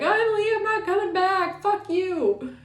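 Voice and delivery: runaway yelling back that she won't return; defiant runaway. Retold or imitated. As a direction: fling it back at the family as you flee. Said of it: "You're like".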